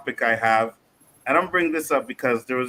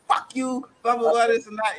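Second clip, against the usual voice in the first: mouthy defiance; 9 semitones higher than usual. Retold or imitated. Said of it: "a friend who was ignorant".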